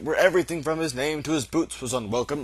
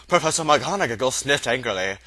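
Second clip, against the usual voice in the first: plain reading voice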